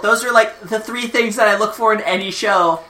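put-on high, eager little kid voice; wholesome 1950s kid. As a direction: play a gee-whiz sixties kid. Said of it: "I'm a sixties ten-year-old".

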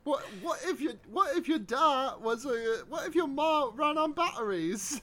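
high, laughing silly voice; mock quote. Mocking a silly movie line to a friend, high and giggly. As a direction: put on a goofy voice through a laugh.

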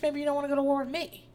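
quick, offhand mock retort; casual comeback. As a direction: toss it back lightly, not shouting. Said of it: "Carla is like".

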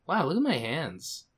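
soft, dazed murmur to yourself; low, hushed stoner musing; stoned wonder. Retold or imitated. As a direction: imitate being quietly entranced by your own hands. Stoned wonder, retold at work.

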